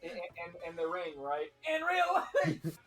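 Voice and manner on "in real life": Sam Hyde impression. Continuing Sam Hyde bit.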